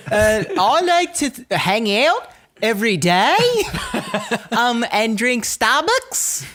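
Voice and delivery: put-on high, slurred valley girl voice; ditzy valley girl with a thick, numb tongue; airy valley girl, tongue gone numb. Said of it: "like a valley girl with a numb tongue".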